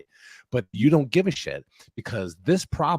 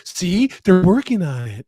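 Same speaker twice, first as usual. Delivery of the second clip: higher, louder smug mock defence; smug liberals; 8 semitones higher, 9 dB louder than usual. Mocking liberals defending the border plan, higher and louder. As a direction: chirp it like a self-satisfied partisan. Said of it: "liberals are like".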